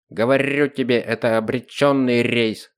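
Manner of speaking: usual speaking voice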